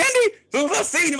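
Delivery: ordinary delivery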